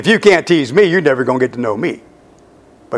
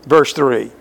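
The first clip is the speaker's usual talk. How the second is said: usual speaking voice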